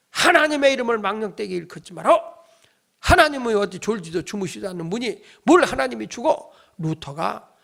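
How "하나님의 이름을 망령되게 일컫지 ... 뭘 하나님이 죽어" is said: acted indignant outburst, raised and loud; angry yelling. Luther shouting at his wife that God cannot die, angry yelling. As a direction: scold loudly as an outraged husband. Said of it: "루터가 화가 나가지고 소리소리를 지릅니다".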